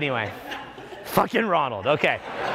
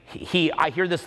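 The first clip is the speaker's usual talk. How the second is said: ordinary talk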